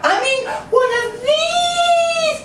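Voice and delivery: high slow laughing wail; squealing girl. Retold or imitated. Mimicking the girl showing him, high slow wail. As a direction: drag it out in her shriek. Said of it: "She's like".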